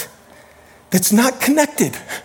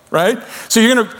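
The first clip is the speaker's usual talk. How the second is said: normal talking voice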